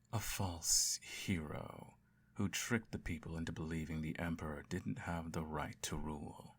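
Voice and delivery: plain reading voice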